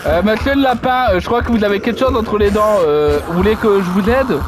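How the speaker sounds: low, put-on courteous voice for the rabbit; mock polite gentleman voice. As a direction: play a stiff, very polite gent. Politely addressing the game rabbit.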